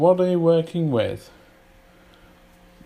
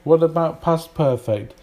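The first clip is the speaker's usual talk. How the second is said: usual speaking voice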